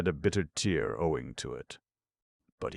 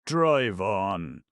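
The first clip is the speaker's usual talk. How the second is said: loud, higher angry command; angry shout; 5 semitones higher, 7 dB louder than usual. A narrator's reading. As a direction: bark the order at the coachman. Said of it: "Ivan shouted angrily to the coachman".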